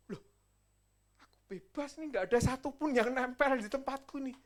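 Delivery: ordinary delivery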